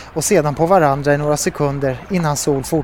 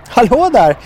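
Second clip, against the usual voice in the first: loud, high-pitched hello; cheerful hail; 8 semitones higher, 5 dB louder than usual. A narrator's reading. Sol landing on the fishermen's boat, cheerful hail. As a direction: hail them brightly from a distance. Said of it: "vinkade Sol glatt".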